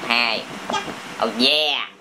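ordinary talk, nothing marked

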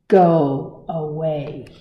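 slow, loud grumpy hippo brush-off. Acted. Hippo telling the bird off, loud and slow.

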